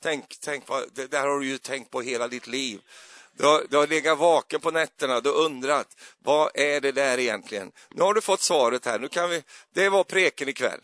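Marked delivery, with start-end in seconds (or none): 3.3-10.8 louder, hammed-up preacher build; mock revival preacher, louder; mock dramatic. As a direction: hype it up like a pretend grand revelation. Mock grand sermon ending.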